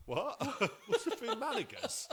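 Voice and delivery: high, loud, laughing "What's the Fumanigus?"; can't believe it, laughing; high laughing bafflement; laughing disbelief. Retold or imitated. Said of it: "I'm like".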